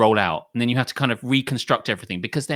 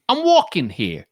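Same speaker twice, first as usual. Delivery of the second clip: put-on Italian-American accent; 5 semitones higher, 5 dB louder than usual. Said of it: "I really can't do that accent".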